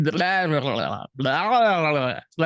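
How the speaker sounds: floppy-tongued "blah blah" noises; loose-tongue babble. Shown as a demonstration. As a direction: let the tongue flap loosely through nonsense. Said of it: "my tongue is just like".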